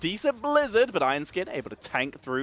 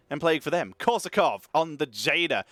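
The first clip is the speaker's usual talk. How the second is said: ordinary talk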